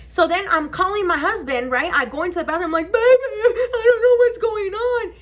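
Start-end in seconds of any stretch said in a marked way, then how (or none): 2.9-5.1 high, slow, loud wail; whiny, drawn-out imitation sob; mock crying. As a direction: wail to your husband in hammed-up tears. Crying call to husband.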